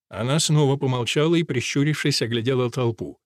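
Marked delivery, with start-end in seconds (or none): none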